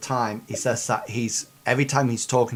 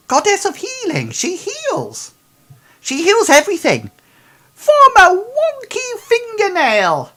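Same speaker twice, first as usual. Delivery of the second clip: much higher, louder, fast; ecstatic praise; 10 dB louder than usual. Acted. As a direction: preach it with zeal. NPC praising goddess.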